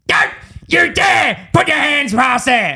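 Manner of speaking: high, slow furious yell; angry shout. Retold or imitated. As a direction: snap at the apprentice to stop. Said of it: "And he goes".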